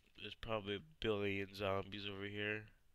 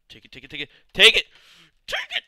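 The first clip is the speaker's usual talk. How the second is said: building from urgent to loud high shouts; real frantic yells; frantic yelling; 8 semitones higher, 18 dB louder, much faster than usual. Real, not acted. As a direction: scream it louder each time. Frantically swinging at zombie.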